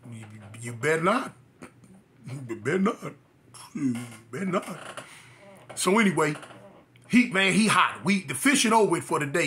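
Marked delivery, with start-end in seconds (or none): 0.1-5.0 low, soft muttered threat; muttered warning. Retold or imitated. Boy daring his angry uncle, low muttered warning. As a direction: mutter it under your breath.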